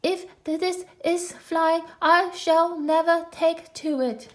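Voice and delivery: high, slow, shaky squeak of fear; a small animal jolted about, squeaking his complaint; squeaky frightened Piglet. Acted. Piglet bumped about in a pocket, high frightened squeak.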